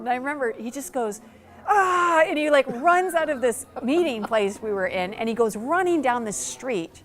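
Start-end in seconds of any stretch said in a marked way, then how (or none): 1.7-2.2 mock cry. Rob cries out, runs off.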